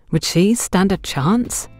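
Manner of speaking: ordinary delivery, nothing marked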